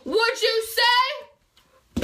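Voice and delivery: high, drawn-out challenge; acted confrontation. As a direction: mimic someone demanding it, slow and high-pitched. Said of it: "and she goes".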